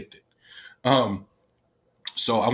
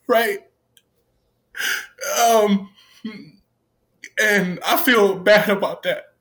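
choked up, voice pitched higher with emotion; real tearful upset; 6 semitones higher than usual. Real, not acted. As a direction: talk through real tears, voice raised. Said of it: "y'all see me cry right now".

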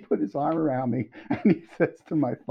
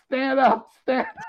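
high-pitched, urgent mock urging; urgent prompting; 13 semitones higher, 4 dB louder than usual. Gene tells the songwriter to get up, urgent prompting. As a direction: imitate someone urging a friend to get up.

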